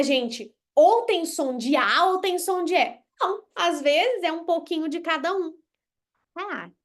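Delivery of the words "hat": "Hat" much softer, vowel between a and e. Shown as a demonstration. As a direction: say "Hat" quietly with the mixed vowel. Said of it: "É um pouquinho do A, um pouquinho do E".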